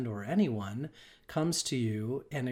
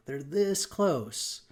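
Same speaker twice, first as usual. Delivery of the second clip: usual speaking voice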